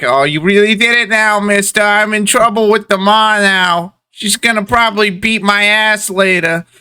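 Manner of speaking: put-on high-pitched kid blaming the cabbie; aggrieved paperboy child. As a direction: complain in a piping newsboy voice. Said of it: "he's like".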